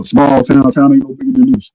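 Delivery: normal talking voice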